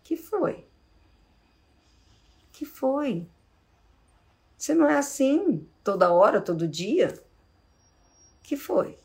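tender worried question to a partner; gentle asking. Shown as a demonstration. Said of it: "É só assim".

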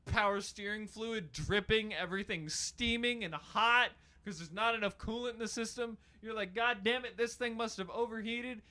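high, loud, worked-up storytelling; hammed-up rant. Hammed-up car repair rant.

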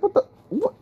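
very high squeaky disbelief; flabbergasted. Retold or imitated. Customer watching another man get coffee, flabbergasted. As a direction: sputter it, voice shooting way up. Reacts to coffee given away.

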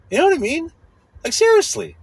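exasperated, pitch shooting up; irritated high voice. Real, not acted. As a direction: voice climbing up as annoyance takes over. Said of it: "my voice is getting high 'cause I'm irritated".

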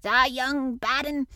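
loud, slow outraged insult. A narrator's reading. Gardener calling down at Mary, outraged. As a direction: spit it down at her in outrage. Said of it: "He was so outraged".

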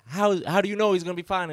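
high, fast, anxious mom imitation; mock the panicked mother quickly; worried mom. Retelling the worried mom at the campsite, high and fast.

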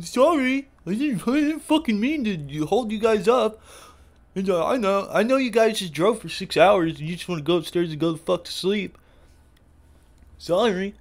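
high, loud, whiny mock apology; mocking the bad driver. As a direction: ham up a clueless driver's sorry. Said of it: "finally he rolls his window down".

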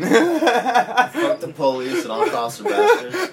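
high, slow, through laughter; laughing joke answer. Acted. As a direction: crack up while delivering the punchline. Joke answer about tattoos, amid laughter.

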